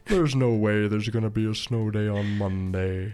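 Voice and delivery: mope through it; sad voice. Pretend. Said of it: "let me put on my sad voice again".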